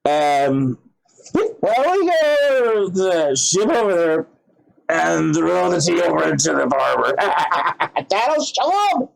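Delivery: hammed-up drunk rabble-rouser, calling out; drunken colonist. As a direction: act a drunk egging on his mates with a laugh. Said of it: "pissed drunk and railing against the king".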